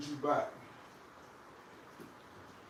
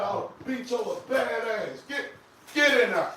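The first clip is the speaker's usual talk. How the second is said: higher-pitched telling-off; scolding the dog; 7 semitones higher than usual. Real, not acted. Owner scolding the dog for taking his hat, higher mock-stern telling-off. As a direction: wag a finger at her with your voice, mock stern.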